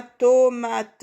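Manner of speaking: TOMATE slow and high, split to-ma-te; syllable by syllable. Shown as a demonstration. Teacher locating the O for pupils, syllable by syllable. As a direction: stretch "tomate" over three beats. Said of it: "dans la première syllabe".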